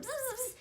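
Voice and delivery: high, soft imitated buzzing; soft, high buzzing sound; buzzing noise. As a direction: buzz like jittery energy. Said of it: "I was like".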